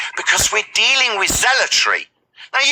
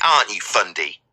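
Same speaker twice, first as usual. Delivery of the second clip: normal talking voice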